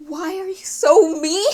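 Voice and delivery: real frustrated complaint at a character; tearful frustration. Real, not acted. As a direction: whine it as a helpless protest. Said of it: "I'm tearing up from frustration".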